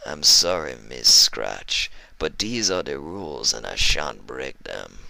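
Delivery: put-on low, whispered northern-accented thug; menacing northern heavy. Iceberg promising cruelty to the captives, low whispered menace. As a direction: purr it, low and sinister.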